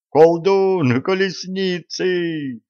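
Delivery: high urgent alarmed shout. Acted. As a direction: yell the warning at full voice. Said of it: "в голос закричал Львовод".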